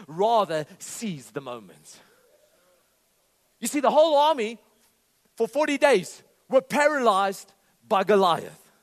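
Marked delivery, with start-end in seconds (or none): 3.6-8.7 loud, driving preacher's proclamation; khotbah berapi-api. Acted. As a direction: preach it at full force, building higher and louder. Bursts back into forceful preaching.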